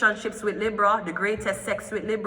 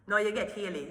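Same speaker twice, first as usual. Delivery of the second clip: ordinary talk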